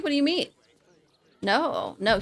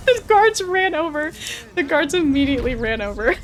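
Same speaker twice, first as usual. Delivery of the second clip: high, loud words through laughter; helpless amusement, laughing as you speak; 6 semitones higher, 6 dB louder than usual. Real, not acted. Laughing at guards running over.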